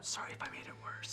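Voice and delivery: very soft whispered apology; whispered aside. Real, not acted. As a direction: slip it in under your breath. Hushed aside to the game master.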